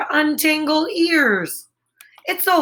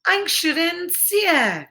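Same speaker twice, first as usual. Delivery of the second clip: mock German; 5 semitones higher, slower than usual.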